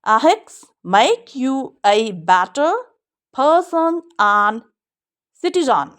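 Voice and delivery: very slow, each word drawn out; slow model reading. Shown as a demonstration. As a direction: read the sentence slowly for the class. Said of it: "model sentence read".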